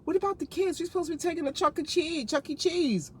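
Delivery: a hint of a whiny wife; lightly voiced nagging wife; mock wife. Wife reminding husband of kids' plans, lightly whiny. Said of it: "his wife would be like".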